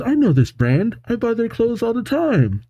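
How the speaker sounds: high-pitched imitation of a woman; woman's voice. Voicing the woman, now delighted.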